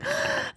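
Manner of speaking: sharp high gasp of dismay; mock gasp. Inner child gasping at ruined work. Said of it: "I was like".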